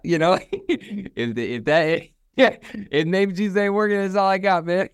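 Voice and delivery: breaks into high laughter mid-line; giggling while telling it; laughing. Real, not acted. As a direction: laugh it out, amused at yourself. Laughing at own story.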